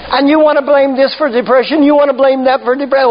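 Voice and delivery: usual speaking voice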